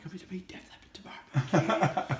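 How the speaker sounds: whispered, thrilled under the breath; excited whisper. Retold or imitated. Guest recalls a sleepless night, whispering with thrill. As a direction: whisper it like a secret you can't contain. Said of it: "I was like".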